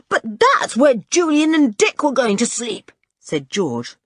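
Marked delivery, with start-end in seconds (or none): 0.1-2.9 high, loud indignant protest; losing temper. A narrator's reading. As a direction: object hotly, voice raised. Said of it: "losing her temper again".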